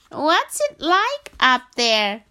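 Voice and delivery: high, loud, slow frog calling up to giraffe; a little frog calling far upward. Acted. Frog voiced, asking giraffe.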